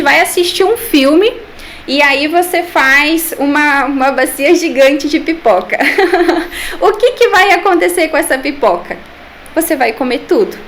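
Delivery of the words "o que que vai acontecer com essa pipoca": chuckles, then asks through the laugh; a real laugh spilling into the question. Real, not acted. Nutritionist tells the interviewer about a giant popcorn bowl, laughing.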